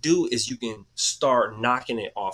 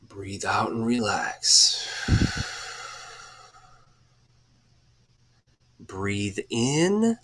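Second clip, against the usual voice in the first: guided breathing; 3 semitones lower, much slower than usual. Acted. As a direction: ease the listeners through each breath, unhurried. Leads a guided breathing exercise.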